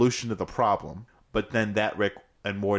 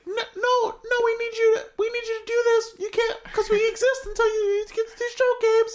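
high, eager cartoon helper voice, laughing through it; Mister Meeksy voice. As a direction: squeak it up like a chipper little helper who exists to serve. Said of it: "And he's like".

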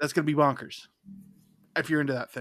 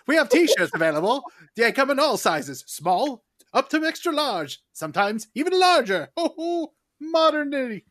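louder, lightly hammed old-timey announcer; 7 semitones higher, 5 dB louder than usual. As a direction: proclaim it like an old-fashioned huckster. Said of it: "Maybe I should just go with old-timey voice".

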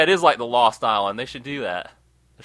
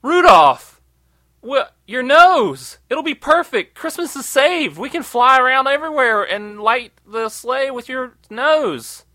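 high, loud, slow, gushing Santa voice; hammed-up Santa suddenly all sweetness; high, drawn-out fake-cheerful Santa voice; mock-jolly Santa; 10 semitones higher, 7 dB louder, slower than usual. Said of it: "Santa Claus is like".

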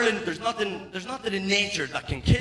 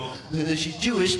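mad babble with wordless noises; delirious raving; slower than usual. Acted. Girlfriend sees him in the corner, delirious raving. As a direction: rave it out, words lost in babble. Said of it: "in my fucking delirium".

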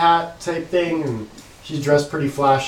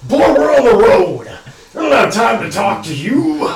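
put-on gruff old caravan boss; 6 semitones higher, 5 dB louder than usual. Caravan owner scolds the guard.

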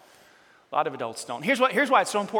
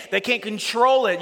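ordinary talk, nothing marked

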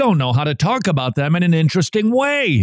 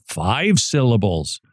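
ordinary delivery

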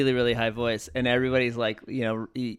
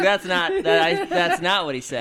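laughing protest; 6 semitones higher, 4 dB louder than usual. Retold or imitated. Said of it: "I was like".

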